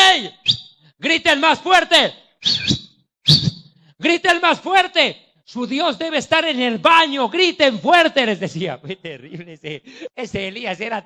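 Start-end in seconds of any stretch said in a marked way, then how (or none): 0.1-8.3 loud, slow taunting "griten más fuerte"; mocking shout. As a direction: jeer at the prophets of Baal, loud and slow. (Elías burlándose a gritos de los profetas de Baal)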